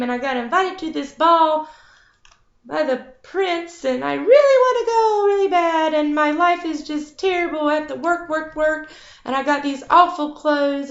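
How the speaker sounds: hammed-up self-pity; whiny Cinderella. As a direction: rattle off her woes like a sulky princess. Cinderella complains about stepfamily.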